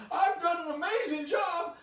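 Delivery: high, loud self-congratulation; hammed-up gleeful brag; boastful crowing. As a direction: shout it up high, bursting with pride. Boy boasts about his test.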